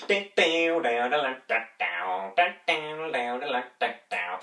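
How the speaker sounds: vocalised twangy guitar riff, starting high; imitated guitar riff. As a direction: mouth a guitar lick, high then sliding down. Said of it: "I love Homer's guitar going".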